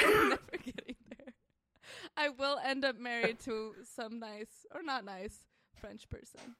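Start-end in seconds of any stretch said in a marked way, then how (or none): 0.0-1.3 laughing. Real, not acted. Laughing agreement, loud.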